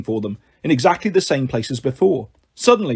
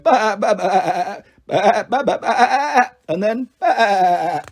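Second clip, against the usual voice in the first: sheep bleat; 4 semitones higher, slower than usual. Acted. Malcolm bleating at the goat.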